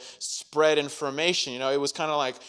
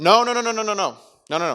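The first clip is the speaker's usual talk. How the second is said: higher, louder string of no; mock panic, higher and louder; panicked no's; 7 semitones higher, 8 dB louder, faster than usual. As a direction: blurt out frantic refusals. Acted Peter panicking at gate.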